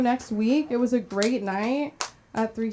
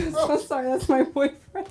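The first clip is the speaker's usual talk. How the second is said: mock apology; 5 semitones higher than usual. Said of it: "I'm like".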